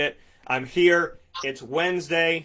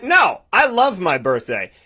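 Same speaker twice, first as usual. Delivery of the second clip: excited retort; 5 semitones higher, 7 dB louder than usual. Retold or imitated. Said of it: "I'm like".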